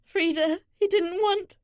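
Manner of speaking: high-pitched, understated tearful voice; sobbing. A narrator's reading. Jilted bride to the one comforting her, mildly sobbing. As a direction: act a mild sob, pitched high.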